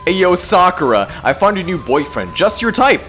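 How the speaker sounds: ordinary talk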